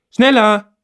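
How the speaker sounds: put-on high, loud nagging girl; shrill-free loud high nag; grumbling. A narrator's reading. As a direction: snap at the locked stall to hurry. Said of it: "meckert das eine Mädchen".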